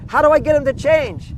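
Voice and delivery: a wife asking the counselor, in mock exasperation; imitating a frustrated wife. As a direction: ham up a wife pleading for help. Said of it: "if she says".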